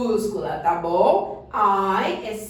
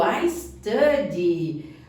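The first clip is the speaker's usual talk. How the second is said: very slow model answer; 5 semitones lower, much slower than usual. Shown as a demonstration. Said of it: "eu estudo".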